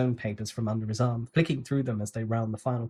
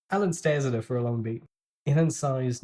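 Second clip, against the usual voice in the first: plain reading voice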